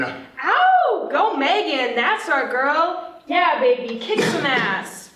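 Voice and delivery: loud whooping cheer for Megan; root loudly for Megan to goad Violet. Acted. Roxanne cheering the TV to taunt Violet, loud whoops.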